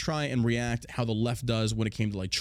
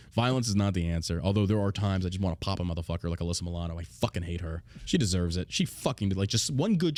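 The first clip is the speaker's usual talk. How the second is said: ordinary talk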